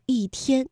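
slow, "一" as falling yì before 天; 一 in fourth tone. Shown as a demonstration. Teacher demonstrates for learners, slowly, "一" falling as yì. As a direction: pronounce "一" with a fourth tone. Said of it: "所以一天的一读一".

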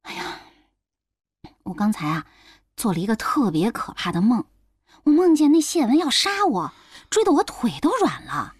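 fearful recounting. Acted. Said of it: "面有惧色".

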